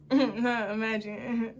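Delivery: slow, soft echo of "Imagine" through laughter; laughing echo. Retold or imitated. Repeating his line to the audience, slowly laughing. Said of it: "Imagine if you just went home".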